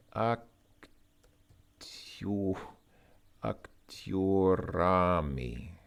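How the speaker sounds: haltingly drawn out word by word; slow sounding out. Real, not acted. Learner piecing together a Russian phrase, halting and slow. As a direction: stretch играемый and актёрами slowly, testing each syllable.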